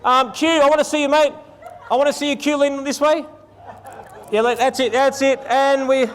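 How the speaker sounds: loud, high calls across the set to the group; calling out. Real, not acted. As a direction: project over the whole bridal party, upbeat. Directing bridal party across location.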